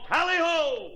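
high, drawn-out rallying cry; yelled. Acted. Boaster re-enacting his dog sled start, yelled. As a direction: holler it like a sled driver. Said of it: "and yelled".

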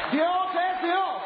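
high, slow, ringing shout; proclaimed shout. Real, not acted. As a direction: bellow it out to the congregation. Preacher proclaims at the climax.

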